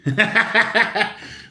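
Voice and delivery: big high burst of laughter; loud high laugh. Real, not acted. Laughing at his old photo.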